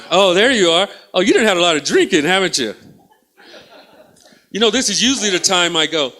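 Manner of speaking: ordinary talk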